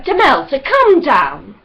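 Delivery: loud command up into the branches; calling out. A narrator's reading. Ross ordering Demelza down from the tree, loud. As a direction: call her down firmly. Ross calls to climbing girl.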